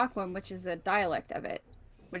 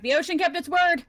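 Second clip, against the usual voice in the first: loud, raised-pitch shout; yelling back; 7 semitones higher, 6 dB louder than usual. Acted. Character yells back to the one invested in this story, shouting. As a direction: call it out loudly over a distance. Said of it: "he yells back".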